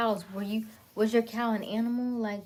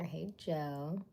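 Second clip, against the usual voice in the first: normal talking voice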